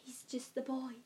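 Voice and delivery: very soft, almost whispered quote; hushed quotation; quoting. Acted. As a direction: recite the line under your breath. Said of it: "ich, ich zitiere".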